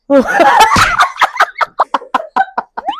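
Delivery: long, high shrieking laughter; delighted cackle. Real, not acted. Vlogger repeating the pale-to-glowing reveal, delighted cackle. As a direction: burst out laughing and keep going. Repeats the sudden transformation, cracks up.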